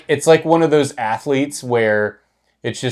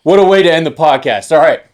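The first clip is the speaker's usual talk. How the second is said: louder, higher, quick amused goodbye; excited sign-off; 6 semitones higher, 8 dB louder than usual. Real, not acted. Host signs off the podcast to the boys, excited and loud. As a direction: wrap up while still laughing.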